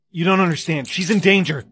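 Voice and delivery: loud, high-pitched frantic pleading; desperate plea. Acted. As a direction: shout a warning as you're held back. Pleading while being arrested.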